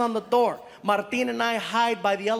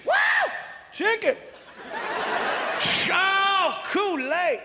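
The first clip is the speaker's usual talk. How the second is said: excited whoops; 9 semitones higher than usual. Retold or imitated. Man unpacking the gift basket, whooping with joy. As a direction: cheer at every treat like a kid. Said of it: "He's getting excited".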